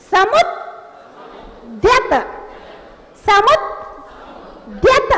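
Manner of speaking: "समुद्यत" as samud, silent hold, then dyat; very slow "समुद्यत", tongue held on the d before releasing; one-second pause mid-word. Shown as a demonstration. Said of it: "एक second के लिए रुक जाइए".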